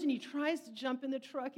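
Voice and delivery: normal talking voice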